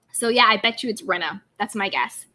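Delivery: normal talking voice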